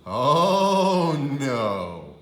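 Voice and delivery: loud groaning oh, no; dad's loud dismay; hammed-up horror. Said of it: "said her father".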